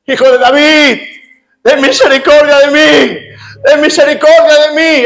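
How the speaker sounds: high, pleading call that climbs; blind man crying out. Retold or imitated. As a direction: beg for mercy at the top of the voice. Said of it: "él seguía gritando".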